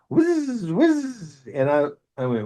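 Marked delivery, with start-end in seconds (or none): 0.1-1.4 mock drill whine, much higher; drill noise. Paramedic shows his drill, mimicked with a mock drill whine. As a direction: make the little drill whir, whiz whiz.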